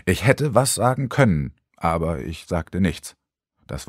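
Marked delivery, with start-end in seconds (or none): none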